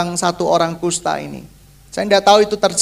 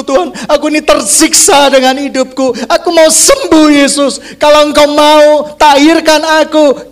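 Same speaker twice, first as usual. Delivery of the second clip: building from pleading to a loud, higher cry to Jesus; desperate pleading prayer; 5 semitones higher, 6 dB louder than usual. Retold or imitated. Worship leader begging Jesus for healing, a rising loud plea. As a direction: beg louder and louder, voice rising. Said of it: "minta Tuhan Yesus mengasihani hidup kita".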